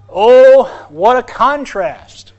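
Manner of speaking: loud, slow, drawn-out exclamation; loud, slow cry of amazement; dramatic exclamation. Real, not acted. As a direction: belt it out to wake the church.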